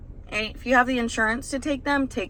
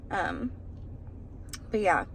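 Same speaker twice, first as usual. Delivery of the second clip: normal talking voice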